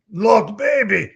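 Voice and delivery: slow, loud Russian brute; Heavy's voice. Pretend. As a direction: drag it out like a hulking gunner. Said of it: "could you read this in Heavy's voice".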